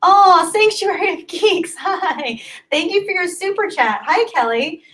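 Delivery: excited greeting. Real, not acted. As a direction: greet them with real delight. Excited thanks for super chat.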